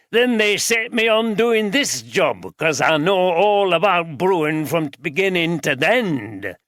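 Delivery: put-on an old Yorkshireman barges in, broad Yorkshire accent. A narrator's reading. Voiced character Duffield.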